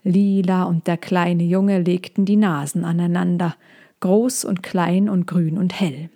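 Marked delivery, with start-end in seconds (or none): none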